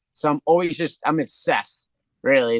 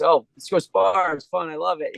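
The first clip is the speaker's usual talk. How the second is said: mock eager kid; 5 semitones higher than usual. Fighter mimicking his childhood self, light mock eagerness. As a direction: play your younger self, naive and keen. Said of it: "I used to just".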